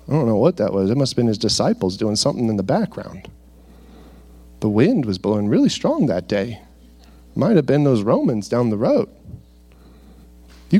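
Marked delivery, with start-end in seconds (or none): 0.1-9.1 lightly mocking a doubter who shrugs it off; skeptic's excuse. Pastor mimicking a doubter explaining it away, low and mocking. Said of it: "can you justify it as".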